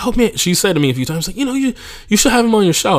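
imitating the co-owner nagging him to book her brother-in-law; mock co-owner. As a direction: chirp it like a pushy co-owner. Co-owner quoted pushing brother-in-law.

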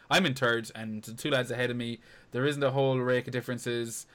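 ordinary talk, nothing marked

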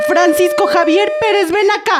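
calling the son's full name up high, stern; scolding call. Acted. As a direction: summon the boy who's in trouble. Mamá llama al hijo regañando. (mamá llamando enojada a su hijo)

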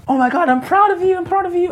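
high, gushing mock friend voice. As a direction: gush like an overexcited friend. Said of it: "And they're like".

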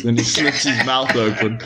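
usual speaking voice

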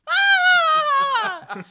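loud high villain shriek; unhinged villain shriek. Acted. Cry before the laugh request.

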